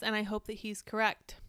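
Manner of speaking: ordinary talk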